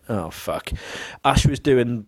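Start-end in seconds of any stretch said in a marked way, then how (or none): 0.1-0.7 muttered dismay. Retold or imitated. Dismayed under breath.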